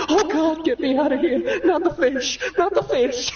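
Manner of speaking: high, frantic hammed-up panic; mock panic. Jonah having a flashback at the fish dinner, hammed-up panic. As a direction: freak out at the sight of fish.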